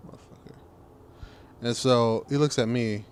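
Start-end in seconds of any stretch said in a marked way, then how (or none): 0.1-0.5 silent mouthed curse. Retold or imitated. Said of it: "I just like".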